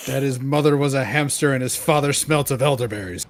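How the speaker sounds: loud, fast lizard voice; hissing reptile growl. Acted. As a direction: hiss the insult like a cocky lizard. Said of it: "in lizard voice".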